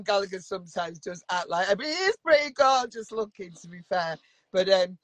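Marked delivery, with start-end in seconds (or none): none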